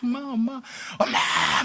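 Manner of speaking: high, repeated cry for mother; retold child screaming. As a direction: imitate a desperate child calling out. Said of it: "막 이 소리 질렀어요".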